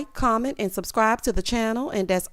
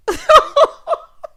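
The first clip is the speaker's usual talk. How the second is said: high burst of real laughter; shrieking laugh; loud laugh; 10 dB louder than usual. Real, not acted. Cackling at the story.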